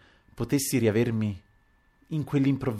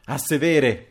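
loud, raised command to sit; sharp, higher-pitched command; shouted order; 4 semitones higher, 5 dB louder, slower than usual. A narrator's reading. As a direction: order everyone down, voice lifted. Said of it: "gridò il signor Anselmo".

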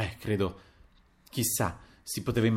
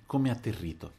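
usual speaking voice

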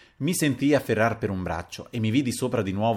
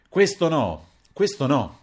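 interjected objection; 6 semitones higher, 4 dB louder, slower than usual. A narrator's reading. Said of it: "interloquì Papiano vedendomi smaniare".

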